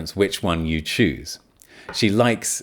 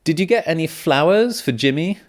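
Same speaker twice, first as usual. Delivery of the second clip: higher-pitched questioner in an example dialogue; acted question; 9 semitones higher, 4 dB louder than usual. Someone checks on flowers for Jimmy, acted question. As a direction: ask it high, as another person. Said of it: "let's look at this conversation".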